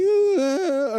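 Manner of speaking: high-pitched groggy "ah"; mock woozy moan. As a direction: act out slipping under with a high moan. Acts going back under anesthetic.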